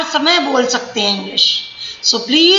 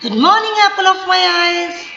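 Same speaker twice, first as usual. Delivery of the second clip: higher, tender mother's greeting; loving mother; 5 semitones higher than usual. Shown as a demonstration. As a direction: greet your child lovingly. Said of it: "See my tone, my stress". (माँ का प्यार भरा अभिवादन)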